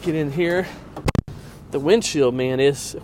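usual speaking voice

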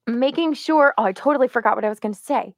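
ordinary talk, nothing marked

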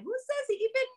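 high sneering inner doubter; mocking inner voice. A mother voices the taunting doubt in her head, high and sneering.

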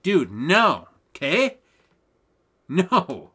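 firm no, pitch jumping up; emphatic loud no. Real, not acted. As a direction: shut it down hard. Emphatic refusal.

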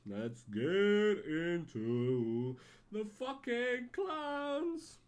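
slow, loud, drawn-out and high; hype announcer. Pretend. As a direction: bellow it like a ring announcer.

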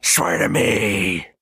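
slow, drawn-out throaty growl; growled command, stretched out; masked-hero growl with a blocked nose. Acted. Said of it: "like a throaty growl".

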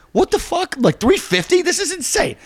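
very high, squeaky disbelief; incredulous falsetto-like protest; mock outrage. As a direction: play up the outrage in a high-pitched voice. Retold outrage at tow price.

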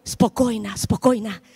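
calm her down gently; preacher soothing a frightened passenger; soothing reassurance. Retold or imitated. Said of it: "Y yo le digo".

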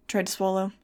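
ordinary delivery

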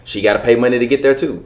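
ordinary delivery

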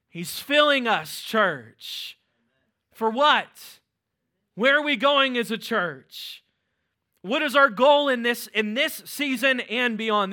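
loud, high, rousing preacher's build; rising sermon fervor; preaching at full force. Real, not acted. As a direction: drive it home to the church. Preaching to congregation.

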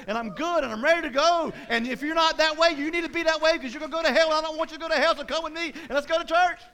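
loud, high-pitched preacher's proclamation; bold declaration. Acted. Believer standing up to declare faith, bold shout. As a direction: shout it out boldly without holding back. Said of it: "got the courage to stand up and say".

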